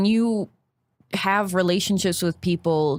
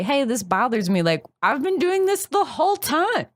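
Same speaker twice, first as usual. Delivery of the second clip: higher-pitched mock protest; acted-out complaint; 7 semitones higher than usual. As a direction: voice a partner protesting, pitch climbing high. Voicing partner's complaint years later.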